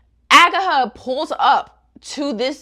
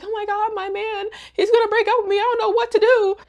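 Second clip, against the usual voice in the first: high, whiny panic; hammed-up frantic fretting; mock hysterics; 11 semitones higher, faster than usual. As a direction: imitate a despairing girlfriend wailing. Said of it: "like, really in hysterics".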